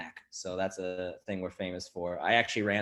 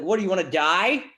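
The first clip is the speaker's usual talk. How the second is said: mock retort; 10 semitones higher, 8 dB louder than usual. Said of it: "It's like".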